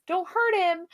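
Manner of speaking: lightly voiced, protective plea; mock plea. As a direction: imitate a worried good guy. Voices good Kirk pleading for dog.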